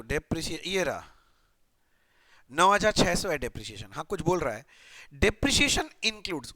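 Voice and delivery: ordinary delivery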